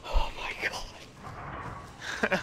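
whispering. Real, not acted. Hiding from zombie.

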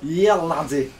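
a quick, hammed-up Scottish brogue; clumsy mock Scottish cheer; bad Scottish accent. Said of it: "worst Scottish accent I've ever done".

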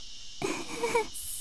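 airy suppressed snicker under the breath; a held-in laugh that escapes as air; breathy stifled laugh. Real, not acted. Girl snickering at the nun's remark, stifled laugh.

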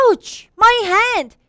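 high, loud pained yelp; acted sharp outcry; crying in pain. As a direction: cry out clutching a hurt hand. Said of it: "he cried with pain".

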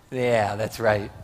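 normal talking voice

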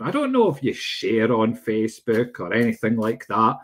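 ordinary talk

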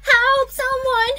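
put-on a child screaming for anyone to come; calling for help. Child alone outside shouts for help, frightened.

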